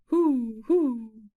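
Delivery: loud drawn-out owl hoots. Acted. Owl hooting at the magpie who wakes him. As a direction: hoot like a sleepy owl. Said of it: "says the owl".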